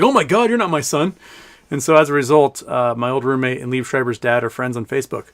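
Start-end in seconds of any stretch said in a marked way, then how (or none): 0.0-1.1 lightly acted startled realisation; mock astonishment. Dad realising he has the wrong man, mildly acted astonishment. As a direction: imitate a startled dad, a little higher.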